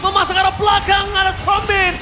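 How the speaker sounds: hyped caster shouting the teamfight; building to a roaring victory call; fighting game announcer. Real, not acted. Caster calls teamfight and win.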